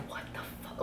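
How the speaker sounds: whispered in shock. Retold or imitated. Seeing blood all over his head, whispered shock. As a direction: breathe it out, stunned. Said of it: "I'm like".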